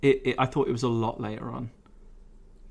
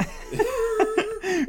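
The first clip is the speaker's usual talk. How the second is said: bright high-pitched burst of laughter; real laugh squeaking upward. Real, not acted. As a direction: laugh up high at your own joke. Laughing at own joke.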